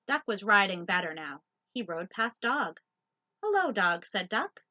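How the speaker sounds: lively loud picture-book read; reading to children. Acted. Teacher reading Duck on a Bike to children. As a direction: read it out big for little listeners.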